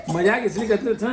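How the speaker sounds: ordinary talk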